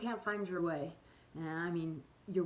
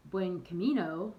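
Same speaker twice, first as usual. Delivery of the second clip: "buen camino" in an American accent, "camino" with an English a; 6 dB louder than usual. Shown as a demonstration. Showing how Americans say it, American accent. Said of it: "like we'd say here in the States".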